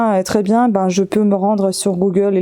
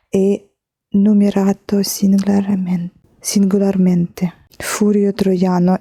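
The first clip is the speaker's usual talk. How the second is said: slow whispered reading of the last words; slower than usual. Real, not acted. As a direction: drop to a whisper and read slowly. Reading the signature, trailing off. (lecture chuchotée et lente)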